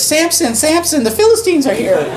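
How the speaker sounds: high-pitched mock alarm, half laughing; hammed-up Delilah calling out in false panic; mock Delilah cry. Delilah wakes Samson, hammed-up high alarm. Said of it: "she says".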